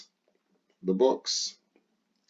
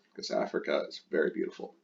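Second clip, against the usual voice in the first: whispered aside; 5 semitones lower, 6 dB quieter, faster than usual. Real, not acted. Teacher's fond aside.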